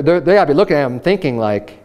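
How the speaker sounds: ordinary talk, nothing marked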